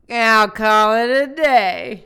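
slow, drawn-out loud sign-off. Acted. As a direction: belt out the sign-off. Sign-off catchphrase.

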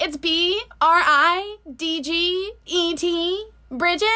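slow, high mock-whiny spelling; put-on whiny drawl; whiny customer. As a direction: spell it out like a pedantic whiner. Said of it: "she was a whiner".

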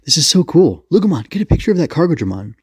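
high, eager gush; excited. Acted. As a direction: geek out and urge your partner on. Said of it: "Eiji was immediately excited".

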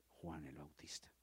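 trailing whisper. Acted. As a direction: whisper the name. Whispered end of sermon sentence.

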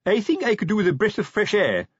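embarrassed sergeant's mild groan; groaned complaint. A narrator's reading. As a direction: plead discomfort, wanting out. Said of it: "he groaned".

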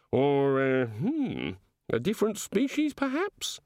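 pondering, then high and inquisitive; curious, puzzled god. Acted. God wondering about a different species, mildly puzzled. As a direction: innocent naturalist's musing.

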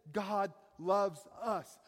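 God loves us, very slow, each word hit hard; slow it right down and land "God loves us"; stress on each word. Acted. Preacher giving the one sure thing, slow and weighty. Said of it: "The Bible is sure of one thing".

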